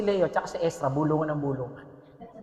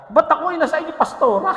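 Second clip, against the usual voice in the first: raised, protesting member asking why me; mock whiny complaint; 6 semitones higher, 4 dB louder than usual. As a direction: whine like a picked volunteer. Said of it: "tao yan, tao, tao".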